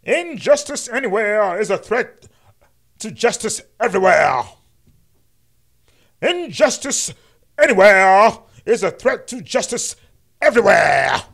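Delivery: loud mock-reverent King oration; MLK impression. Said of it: "I'm gonna do my best M L K impression".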